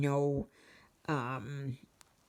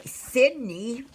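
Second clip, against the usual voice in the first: sharp louder call of the dog's name; calling the dog Sydney mid-sentence, louder; 7 semitones higher, 7 dB louder than usual. Real, not acted.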